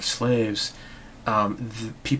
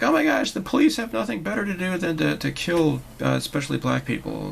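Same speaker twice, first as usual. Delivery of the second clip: a hint of the hysterical voice again, higher; mock alarm; 7 semitones higher, 3 dB louder than usual. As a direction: lightly parody the outraged pundit. Mocking alarmist voice.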